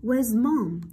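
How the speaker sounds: teacher's model "Where's mom?", falling on "mom"; falling intonation. Shown as a demonstration. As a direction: bring "mom" down at the question's end. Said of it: "Sử dụng tông giọng đi xuống". (đọc "mom" xuống giọng cuối câu)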